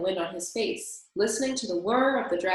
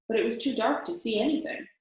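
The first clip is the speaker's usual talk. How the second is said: ordinary delivery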